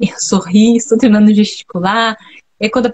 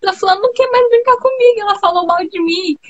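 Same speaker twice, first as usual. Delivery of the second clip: put-on much higher, whiny little-girl voice; child complaining to mom; 8 semitones higher than usual. As a little girl telling her mother a friend turned on her, a high whiny voice. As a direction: play your child self running to mom upset. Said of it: "Nossa, mãe".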